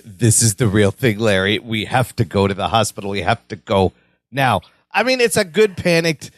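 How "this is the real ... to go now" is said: low, level, composed voice; calm. Retold or imitated. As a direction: keep it low and steady, reassuring him. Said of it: "Jennifer is very calm".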